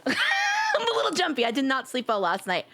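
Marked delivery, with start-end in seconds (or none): 0.0-1.5 high shaky yelp after a jump scare; scared horror game streamer. Real, not acted. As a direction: squeal it, still rattled. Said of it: "Listen, I'm a little jumpy".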